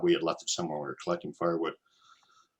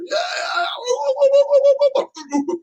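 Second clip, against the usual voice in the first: high, loud whooping shouts; imitated crowd cheering; 6 dB louder than usual. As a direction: whoop like castaways spotting a rescue plane. Reenacting celebrating voices heard.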